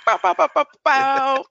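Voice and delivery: loud, high bow bow bow blasts; mock gunshot sounds. Host answers guest with loud mock gunshots. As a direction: fire off playful gun noises.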